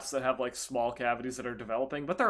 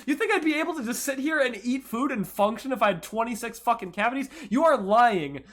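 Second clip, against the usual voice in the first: outraged disbelief; 10 semitones higher, 7 dB louder than usual. Retold or imitated. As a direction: blast her with disbelief, pitched way up and loud. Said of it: "I'm like".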